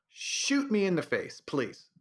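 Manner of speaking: ordinary talk, nothing marked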